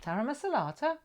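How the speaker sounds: high imitated comeback with rising end; sons' retold reply. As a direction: lilt it up like a cheeky answer. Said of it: "they said".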